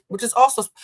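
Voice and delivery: normal talking voice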